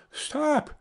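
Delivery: urgent high cry to halt. A narrator's reading. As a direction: call out a warning in a high sparrow voice. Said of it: "cried out".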